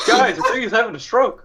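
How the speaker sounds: laughing. Real, not acted. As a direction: blurt it through laughter. Laughing at the giant's noise.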